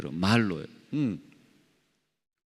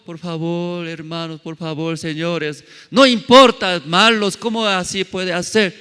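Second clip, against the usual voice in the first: loud pleading; 7 semitones higher, 7 dB louder than usual. Pretend. Pleading amid mock stoning scene.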